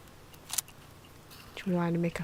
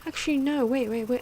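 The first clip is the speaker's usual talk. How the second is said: higher and louder, catching a mistake; sudden realisation; 6 semitones higher, 6 dB louder than usual. Real, not acted. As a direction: stop yourself as it dawns on you. Sudden realisation mid-commentary.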